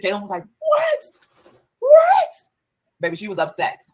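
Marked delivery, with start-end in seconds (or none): 0.6-2.3 very high, loud disbelieving shriek; outraged high-pitched what. Retold or imitated. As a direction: shriek it in shock.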